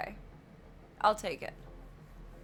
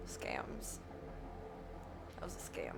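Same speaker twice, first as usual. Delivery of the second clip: much softer, unvoiced complaint; whispered grumble; 3 semitones lower, 13 dB quieter than usual. Real, not acted. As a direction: whisper your disgust. Mutters after failing.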